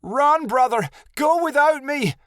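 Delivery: high desperate scream. A narrator's reading. Scene: Jade captured, screaming to her brother to flee. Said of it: "Jade screamed".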